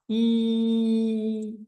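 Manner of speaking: long high ee; high ee sound. Shown as a demonstration. Teacher showing the pitch sound for upper chakras. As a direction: lift it into a high ee. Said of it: "we're gonna use pitch sound".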